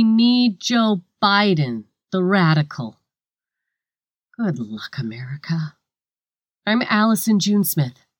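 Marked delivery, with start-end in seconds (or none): 4.4-5.8 ominous whispered goodbye; hushed sign-off. Acted. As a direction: drop to a near whisper. Sign-off after a rant.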